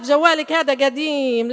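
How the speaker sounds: ordinary delivery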